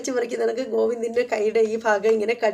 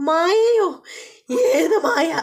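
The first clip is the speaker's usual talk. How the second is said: high, sharp jealous double take; a wife caught off guard, high and loud; shocked wife; 6 semitones higher, 5 dB louder, much slower than usual. Retold or imitated. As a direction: snap back in shocked disbelief. Geethu reacts, jealous wife.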